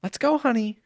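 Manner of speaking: high, tight-lipped mom voice; sweet-stern mom. Retold or imitated. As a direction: chirp it sweetly but firmly. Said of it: "the parent goes".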